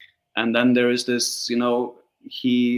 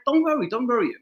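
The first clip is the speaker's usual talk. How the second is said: quoting the casting director; 12 semitones higher, faster than usual. Retold or imitated. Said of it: "she was like".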